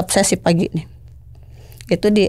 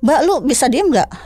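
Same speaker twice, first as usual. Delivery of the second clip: higher-pitched, mildly irritated rebuke; retold snapping; 7 semitones higher than usual. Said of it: "saya bentak".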